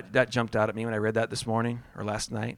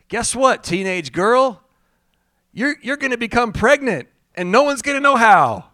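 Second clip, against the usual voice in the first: higher, louder sales-pitch Gabriel; salesman Gabriel; 8 semitones higher, 6 dB louder than usual. Pretend. Gabriel pitching the news to Mary like a salesman, higher and louder. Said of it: "Gabriel had some sales training".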